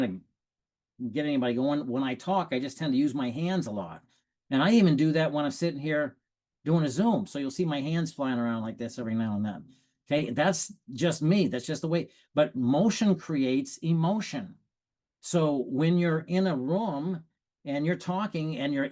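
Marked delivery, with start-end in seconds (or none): none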